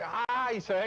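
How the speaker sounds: usual speaking voice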